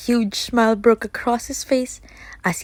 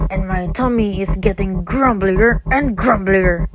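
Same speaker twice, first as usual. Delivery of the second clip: roaring wolf; 5 dB louder than usual. Acted. Hungry wolf roaring in grandmother's house, loud. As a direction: growl out the hunger. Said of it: "roared the wolf".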